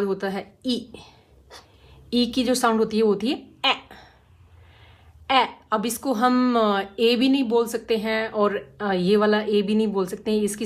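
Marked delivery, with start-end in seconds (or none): none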